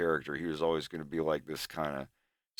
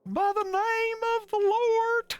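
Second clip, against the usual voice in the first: much higher, louder, slow hammed-up sermon; mock preacher; 5 dB louder, slower than usual. A sudden joke proclamation to chat, hammed-up preaching. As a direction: boom it out like a fire-and-brimstone preacher.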